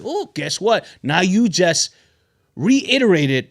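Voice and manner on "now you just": ordinary talk, nothing marked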